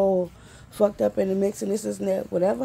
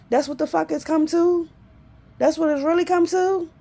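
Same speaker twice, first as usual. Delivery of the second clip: higher, louder, fast desperate rant; anguished shouting; 4 semitones higher, 6 dB louder than usual. Real, not acted. Raging at the life she's stuck in, shouting. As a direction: yell the questions at the world.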